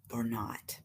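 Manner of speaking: a bare breath of a whisper, barely there. Real, not acted. Asking herself whether to live, in a whisper. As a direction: let the question fall away to a whisper.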